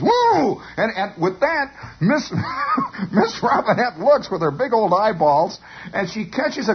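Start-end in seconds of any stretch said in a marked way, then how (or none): 0.0-0.6 high imitated whoop; charging whoop. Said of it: "and he goes". 2.3-3.1 high helpless chuckle; laugh. Real, not acted. Storyteller cracks up mid-sentence.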